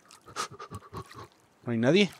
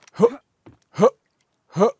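mock laugh; 7 semitones higher, 6 dB louder, slower than usual. Mocking a fallen enemy.

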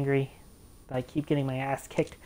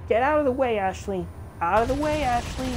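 louder, higher shouts at the partner; loud yell; 6 semitones higher, 4 dB louder than usual. Real, not acted. Gamer telling Ashley to get out of his line of fire, yelling. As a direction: bark at her to move.

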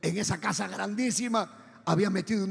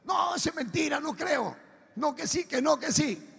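tono agudo de riña, sin subir el volumen; discusión imitada; 7 semitones higher than usual. Retold or imitated. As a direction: imita a amigos que se contradicen. Acting friends arguing back and forth. (discusión actuada, voz aguda)

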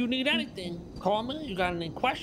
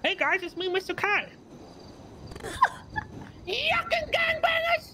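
high, slow shouted clown voice; cackling clown shouting its greeting; slower than usual. Acted. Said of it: "you sound just like Chatty".

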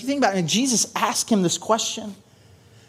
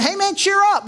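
cheery crowd voice; 13 semitones higher than usual. Retold or imitated.

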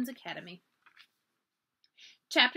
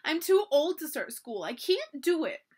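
higher, louder mock-tearful wail; sobbed; 5 semitones higher, 4 dB louder than usual. A narrator's reading. Crying schoolgirl giving up on school, plaintive. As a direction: protest it like an upset child, slowing at the end. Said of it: "Nellie sobbed".

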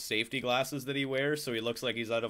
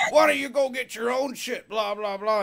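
loud, much higher mock complaint; imitated pissed-off coworker; 12 semitones higher, 5 dB louder than usual. Tow-motor driver kept waiting by coworkers, imitated angry yell. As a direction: yell it like an irritated coworker, exaggerated. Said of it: "he was getting all pissed".

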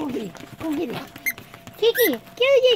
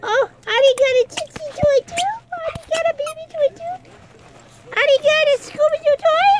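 higher, louder, rising gush; excited pet talk; 8 semitones higher, 4 dB louder than usual. Real, not acted. Gushing over dog's toy.

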